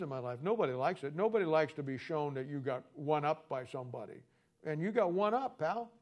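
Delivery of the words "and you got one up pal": raised, needling taunt; mocking the listener, pal; taunting jab. Real, not acted. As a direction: rub it in with a higher jab at the end. Taunting the Bible college graduate.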